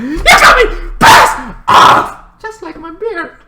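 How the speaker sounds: slow, high, loud angry wail; hammed-up high anger; mock fury. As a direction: drag it out high and mad.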